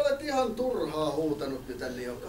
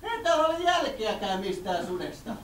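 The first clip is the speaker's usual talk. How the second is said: flustered villager woman; 5 semitones higher than usual. Acted. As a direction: squeal in a panic like a fussy villager.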